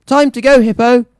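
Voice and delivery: high, loud call across the field; shout. Acted. Mayor shouting at the hippo across the field. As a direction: bellow it at the beast. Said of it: "the mayor shouted again".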